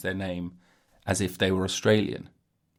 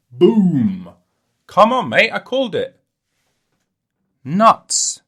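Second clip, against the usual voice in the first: loud, high victory shout; triumph; 9 semitones higher, 10 dB louder, slower than usual. Real, not acted. Podcaster gloating he guessed the squirrel fact, loud and excited. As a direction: crow that you called it.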